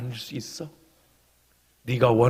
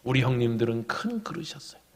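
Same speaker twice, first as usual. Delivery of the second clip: confiding whisper; 7 dB quieter than usual. Real, not acted. Confiding aside about his brothers.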